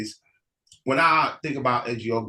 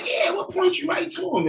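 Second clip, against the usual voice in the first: much higher, eager local voice; helpful townsfolk. Acted. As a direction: point the way, full of cheer. Townsfolk answer.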